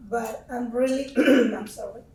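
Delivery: ordinary delivery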